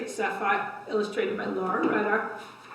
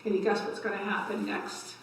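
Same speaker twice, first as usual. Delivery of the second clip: usual speaking voice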